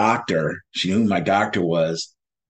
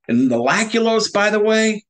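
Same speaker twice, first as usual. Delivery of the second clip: ordinary talk, nothing marked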